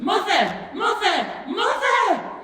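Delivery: high, loud calls climbing each time; excited shouting. A narrator's reading. Charlie bursts through the door to his mother, shouting. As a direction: yell for her as you burst in. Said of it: "Charlie burst through the front door shouting".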